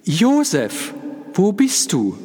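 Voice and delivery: high, loud frightened call; woman calling for her husband; anxious calling. A narrator's reading. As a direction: call out searching in fear. Woman calling fearfully in dark.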